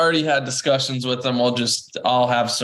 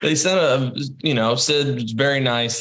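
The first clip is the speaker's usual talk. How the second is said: ordinary talk, nothing marked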